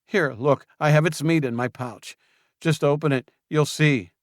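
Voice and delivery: high, loud anxious urging; frightened pleading. Acted. Julian urging Luke to check the pouch, frightened. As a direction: beg him to look. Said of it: "apparently frightened".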